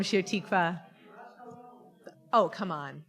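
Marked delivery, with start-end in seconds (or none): none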